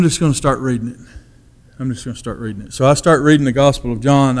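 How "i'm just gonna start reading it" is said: much softer, lower echo of the line; quiet inward resolve; softer repeat. Retold or imitated. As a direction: say it again almost to yourself. Repeating the decision to himself.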